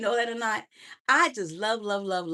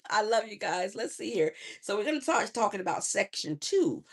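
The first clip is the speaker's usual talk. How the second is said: normal talking voice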